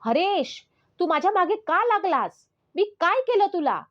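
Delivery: high, loud anguished cry; desperate high-pitched outcry; screamed plea. Acted. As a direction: scream it out at the attacker. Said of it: "मी किंचाळले".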